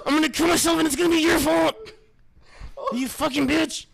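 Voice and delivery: very high, full-volume mock tantrum; hammed-up screaming. Host blaming co-host in a comic rant, hammed-up screaming. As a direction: shriek the blame at your co-host. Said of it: "He's just screaming in my gut".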